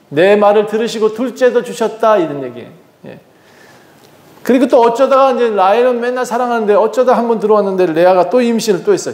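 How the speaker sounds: ordinary talk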